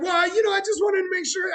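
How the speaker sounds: high, loud imitating a laughing caller; hammed-up high giggly voice; laughing customer. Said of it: "He's like".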